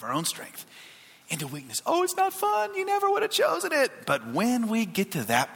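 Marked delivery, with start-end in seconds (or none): 1.9-3.9 very high, thin put-on voice; mock complaint. Pastor voicing reluctance about being in weakness, high mock complaint. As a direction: protest in a squeaky, high-pitched voice.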